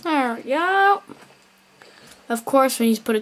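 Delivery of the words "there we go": higher, slow stretched There we go; satisfied slow singsong There we go; drawn-out singsong. Acted. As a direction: sing out There we go, slow and lifted. Repeats, second take drawn out.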